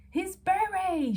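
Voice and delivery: high, slow, loud call over the crowd; shouting. A narrator's reading. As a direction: shout it back over the noise. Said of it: "shouted Missus Brown in return".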